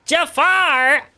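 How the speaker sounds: very high, loud cartoon parrot squawk; parrot from Aladdin. Teen shows how the neighbor sounds, loud high parrot squawk. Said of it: "like, the parrot from Aladdin".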